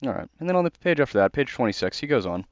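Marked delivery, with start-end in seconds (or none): none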